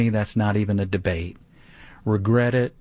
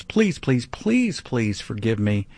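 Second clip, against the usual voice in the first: hammed-up plea that drops away at the end; obsessive pleading. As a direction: beg over and over, put-on. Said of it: "all that obsessive please, please, please".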